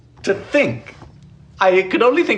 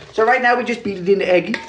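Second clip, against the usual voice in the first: ordinary talk, nothing marked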